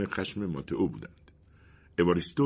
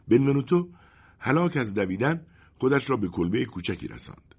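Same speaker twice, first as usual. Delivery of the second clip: usual speaking voice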